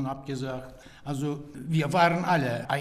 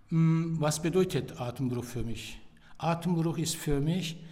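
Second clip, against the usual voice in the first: ordinary talk, nothing marked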